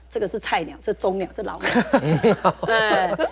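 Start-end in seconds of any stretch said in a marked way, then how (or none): 1.7-2.6 a quick amused laugh with a rising end; short laugh. Real, not acted. Guest laughing at her rookie-nurse joke, amused. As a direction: laugh at your own joke.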